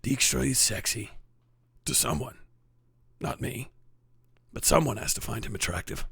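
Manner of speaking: low, soft game-character delivery; Witcher character line. Acted. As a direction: read it low and hushed, pausing between phrases. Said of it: "sounds like a line from The Witcher".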